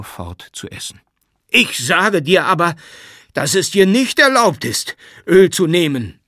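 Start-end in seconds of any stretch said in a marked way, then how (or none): 1.5-6.2 furious high shout, drawing out the prohibition; louder angry shout. A narrator's reading. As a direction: yell it with rising outrage. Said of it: "schrie Jakow noch lauter". (noch lauter, zornig schreien)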